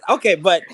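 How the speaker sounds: high loud burst of laughter; loud laughing. Real, not acted. Laughing after joke.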